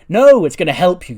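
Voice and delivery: loud, high theatrical insistence; emphatic reassurance. Acted. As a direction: burst in to overrule the doubt. Becky's emphatic reassurance, acted.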